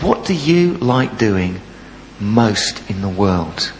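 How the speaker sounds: soft, slow, tender question; gentle teacher coaxing a sulky pupil. Retold or imitated. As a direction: soothe the upset boy.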